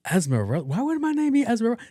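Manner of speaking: high-pitched, quick incredulous question; imitated baffled waitress. Waitress reacting to a wrong name guess, mock incredulous. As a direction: repeat the name in high disbelief.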